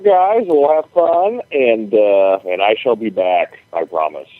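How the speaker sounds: ordinary talk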